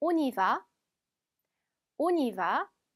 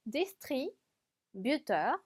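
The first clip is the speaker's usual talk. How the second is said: "distributeur" slowly in two halves, paused mid-word; split in halves; much slower than usual. Shown as a demonstration. Teacher reads "distributeur" half at a time for learners. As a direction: break "distributeur" into two chunks. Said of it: "반씩 해볼까요".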